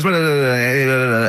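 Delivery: imitating a rapid babbler; fast talking. Imitating his chatty mate Robin, fast babble. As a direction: rattle it off like a non-stop talker. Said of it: "talks really fast".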